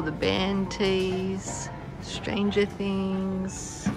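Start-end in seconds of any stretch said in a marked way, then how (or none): none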